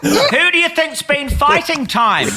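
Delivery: high-pitched, through laughter; laughing protest. Real, not acted. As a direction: laugh out a high, mock-indignant retort. Teased, answers while laughing.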